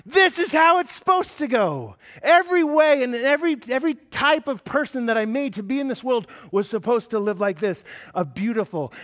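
high, loud, impassioned preaching; preaching at full force. Acted. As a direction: preach it out with rising fervour. Climactic sermon build.

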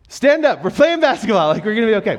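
high, loud urging; coaching call. Retold or imitated. Coach tells Houston to stand up, high and loud. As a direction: cheer the kid back into play.

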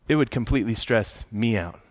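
ordinary delivery

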